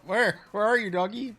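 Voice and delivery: high laughing dog call. Pretend. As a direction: call sweetly to a lost puppy. Calls to dog after laughing.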